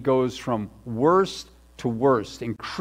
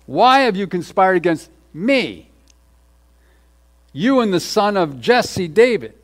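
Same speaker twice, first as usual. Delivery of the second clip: "me" hit firmly, a little higher and louder than the first reading; a raised scripture read with "me" pushed out; stress on me, again; 5 semitones higher, 6 dB louder than usual. Shown as a demonstration. Pastor rereading Saul's accusation to the church, stressing "me".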